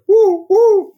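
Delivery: high loud woo call; imitated hunting call. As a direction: call out woo to lure coyotes. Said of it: "making a noise".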